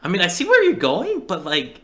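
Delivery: high, hesitant imitation of her; imitating a doubtful girl up high; high mock quote. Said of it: "She's like".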